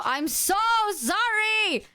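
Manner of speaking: high, slow, pleading sorry; drawn-out apology. Real, not acted. Apologizing to chat for dropping.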